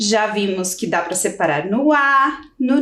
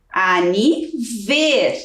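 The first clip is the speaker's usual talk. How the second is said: drawn-out syllables; 2 semitones lower, much slower than usual. Shown as a demonstration. Teacher showing where to cut, very slow. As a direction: stretch out "Aniver" slowly, syllable by syllable. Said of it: "Qual é a próxima sílaba?".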